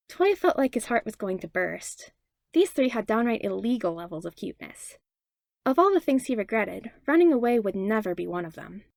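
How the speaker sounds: usual speaking voice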